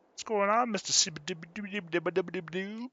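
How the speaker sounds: high-pitched goofy babble greeting; silly greeting voice. Pretend. Streamer greeting a viewer by name, in a silly high voice. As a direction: babble the name in a squeaky mock voice.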